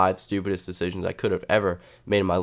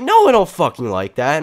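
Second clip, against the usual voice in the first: loud, higher-pitched retold protest; indignant outburst; 6 semitones higher, 7 dB louder than usual. Storyteller answering his attacker's question, loud indignant protest. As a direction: fire back an acted, outraged refusal.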